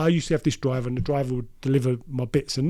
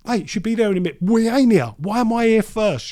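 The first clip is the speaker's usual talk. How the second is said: loud, high-pitched exasperated complaint; having a go; 8 semitones higher, 7 dB louder than usual. Retold or imitated. Dealer berating his mate for not being there first, having a go. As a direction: act out giving your mate an earful. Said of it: "I'm having a go at him".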